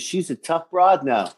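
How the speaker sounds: ordinary delivery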